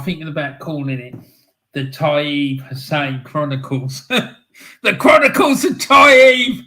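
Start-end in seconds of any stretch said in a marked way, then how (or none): 4.8-6.6 loud, high announcer-style proclamation; grand shout. Real, not acted. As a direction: belt out the title like a trailer. Announcing a grand show title.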